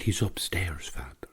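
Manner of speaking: hushed whisper at a sickbed; woman whispering to the priest on the landing. Retold or imitated. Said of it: "and she was whispering".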